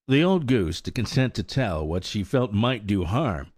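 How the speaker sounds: ordinary delivery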